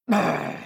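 rolled, growling brr of displeasure; witch's angry brr. Acted. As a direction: trill a cross brr. Witch fuming at the doors.

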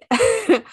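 short high laugh; burst of laughter. Real, not acted. Cohost laughs at the store name, a short high laugh. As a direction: laugh out at the Hi-Fi joke.

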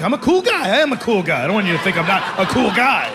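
high, eager I'm a cool guy; flattered, hammed-up bragging; flattered boast. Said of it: "that flattered me".

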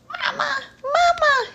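high squeaky call; squeaky little bat. Acted. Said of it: "he squeaked".

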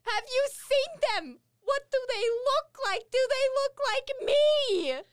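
high, slow desperate pleading; pleading plasmoid. Acted. Gloom begging a stranger about her family, high pleading voice. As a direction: beg him for news of her kin.

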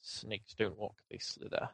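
quiet hissing snake. Acted. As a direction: hiss it like a snake. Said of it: "keep saying it like that".

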